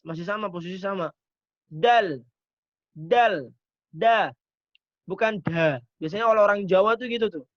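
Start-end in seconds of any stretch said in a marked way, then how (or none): 1.7-4.3 dal said firmly, tongue at the gum ridge; Arabic letter dal. Shown as a demonstration. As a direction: demonstrate dal for the class. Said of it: "Kemudian dal". (huruf dal diucapkan tegas)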